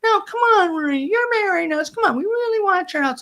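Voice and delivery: high, wheedling mock plea; imitating an excited couple talking someone round; pleading coaxing. Couple coaxing the reluctant officiant.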